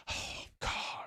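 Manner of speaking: whispered mock disapproval, barely voiced; an appalled stranger, breathed out; breathy disapproving gasp. Stranger in the AMC bathroom, whispered gasp. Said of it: "kinda like".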